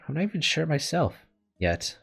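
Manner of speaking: ordinary delivery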